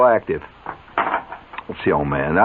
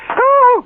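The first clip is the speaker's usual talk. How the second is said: mock shriek, very high and loud; retold scream; 8 dB louder than usual. Mother shrieks at the fake mess under the table, high mock scream. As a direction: shriek in shock at the plastic mess. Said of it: "and she'd scream".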